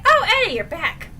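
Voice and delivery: high, loud mock surprise; imitated surprised greeting. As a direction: exclaim as if spotting a lost friend. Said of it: "Jim's like".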